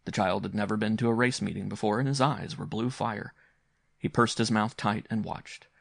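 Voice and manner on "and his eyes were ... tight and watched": plain reading voice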